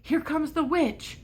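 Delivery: usual speaking voice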